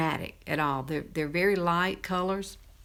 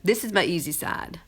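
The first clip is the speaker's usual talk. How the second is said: ordinary delivery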